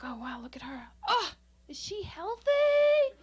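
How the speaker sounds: building to a high, loud, drawn-out swoon; mock awe. Imagined guys at a bar admiring her, mock awe. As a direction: gush with hammed-up amazement.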